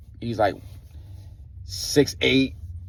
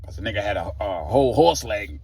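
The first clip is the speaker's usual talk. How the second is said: usual speaking voice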